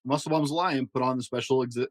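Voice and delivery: ordinary delivery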